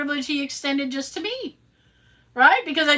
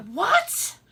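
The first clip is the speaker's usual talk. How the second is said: baffled; 10 semitones higher than usual. Retold or imitated. Baffled reply to survey.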